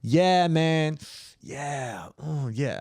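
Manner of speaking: a hint of a laid-back comedian, fading; Andrew Schultz. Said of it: "That's my Andrew Schultz".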